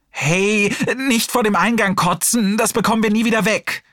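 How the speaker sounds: high, desperate panicked call. Acted. Nurse shouts after fleeing Hassan, high and desperate. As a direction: yell after him in despair, fast. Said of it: "Gottfried klang verzweifelt".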